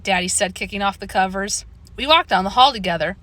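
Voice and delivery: ordinary delivery, nothing marked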